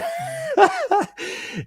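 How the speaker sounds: high squealing laugh; a real, sudden high laugh; burst of laughter. Real, not acted. Host cracking up mid-sentence, high laugh.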